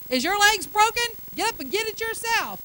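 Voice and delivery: mock rude to family; rude snap. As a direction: bark it at a loved one. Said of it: "to the very people that you love".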